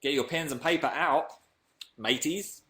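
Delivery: badly faked Australian accent; hammy, unconvincing Australian accent; attempted Australian accent. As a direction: have a go at an Australian twang. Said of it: "That was meant to be Australian".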